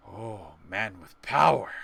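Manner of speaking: slow, odd little goblin voice. Acted. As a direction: play the greedy goblin, drawn out.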